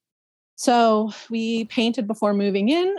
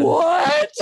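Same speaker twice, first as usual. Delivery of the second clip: high, louder disbelieving what; shocked what; 10 semitones higher, 4 dB louder than usual. Retold or imitated. Reacting to mom's news, high shocked cry. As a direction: gasp out a stunned question.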